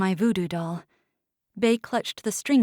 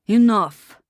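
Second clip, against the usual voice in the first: much louder furious roar; 9 dB louder than usual. A narrator's reading. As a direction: roar to silence them. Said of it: "Isko roared".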